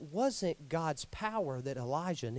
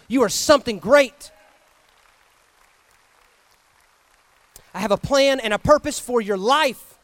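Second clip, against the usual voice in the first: rising to a much louder proclamation; building encouragement; 5 semitones higher, 13 dB louder than usual. Acted. Jesus encouraging the listener, building to a loud proclamation. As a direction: swell it into triumph.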